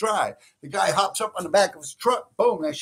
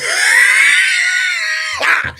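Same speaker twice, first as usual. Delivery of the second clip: shrieking laugh; 10 dB louder than usual. Real, not acted. Laughs recalling being caught.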